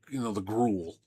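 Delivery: ordinary talk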